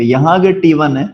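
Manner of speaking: ordinary delivery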